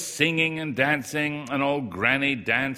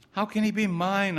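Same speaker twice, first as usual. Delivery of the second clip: arguing; 9 semitones higher than usual. A narrator's reading. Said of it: "I argued".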